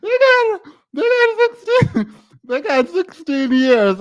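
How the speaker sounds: squealing with laughter. Real, not acted. Streamer reading the sentence to chat, squealing with laughter.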